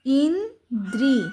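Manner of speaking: ইন্দ্রি drawn out slowly as "iin... drii"; slow teacher's "in-dri"; syllable-by-syllable spelling. Shown as a demonstration. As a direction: sound out ইন্দ্রি in two long beats. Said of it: "দর নিচে রফলা".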